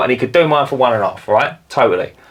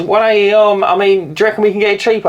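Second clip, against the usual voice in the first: somewhat higher, haggling wife imitation; a touch of the wife balking at the price, voice lifted; mock wife voice; 7 semitones higher than usual.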